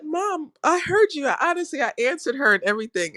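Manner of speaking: high, earnest self-defense; protesting. Retold or imitated. Answering the mother, protesting and high. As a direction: insist you did listen.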